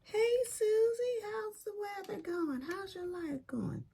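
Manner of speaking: greeting the complainer Susie, falsely sweet; fake cheerful greeting. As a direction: put on a sugary smile. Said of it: "You're like".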